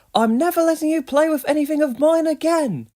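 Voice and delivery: high, loud furious cub; angry shout. A narrator's reading. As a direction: yell the threat at him. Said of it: "Ojo was angry".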